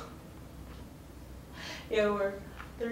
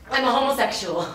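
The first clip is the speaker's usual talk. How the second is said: loud, higher, blurted out; blurted declaration; 5 semitones higher, 7 dB louder than usual. Acted. Teen confessing to the principal, loud blurted declaration. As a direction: announce it boldly, pitched up.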